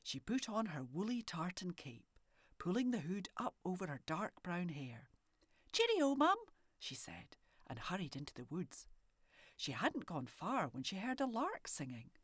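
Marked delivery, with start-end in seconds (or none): 5.7-6.4 put-on high, louder little girl's cheerio; calling goodbye. A narrator's reading. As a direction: call it out as she runs off. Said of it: "girl calling goodbye".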